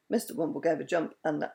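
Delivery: plain reading voice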